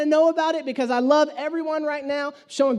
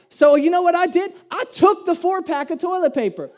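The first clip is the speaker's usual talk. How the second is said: triumphant; 5 semitones higher, 5 dB louder than usual. Real, not acted. Climax of story.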